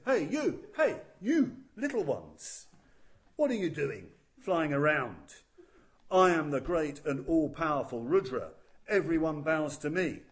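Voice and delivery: loud, booming demand for respect; arrogant shout. Acted. Rudra shouts down at the flying creatures, loud and arrogant. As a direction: bellow down at the little ones like a proud god. Arrogant god calls to intruders.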